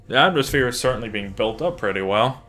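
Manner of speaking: ordinary delivery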